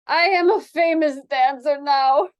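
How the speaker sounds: put-on proud, loud Spanish-accented boast. Dancer boasts to her sister, proud and loud. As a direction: boast like a star. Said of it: "Floriana said proudly".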